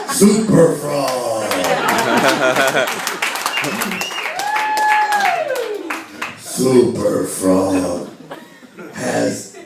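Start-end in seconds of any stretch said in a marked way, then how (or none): 0.1-8.1 slow, drawn-out hero announcement; hero announcer. Pretend. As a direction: proclaim it like a superhero intro. Announces his superhero alter ego.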